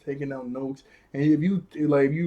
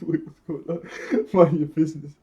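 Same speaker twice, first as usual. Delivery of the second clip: laughing mock quote; 3 semitones higher, 4 dB louder than usual. Cracks up repeating the character's line.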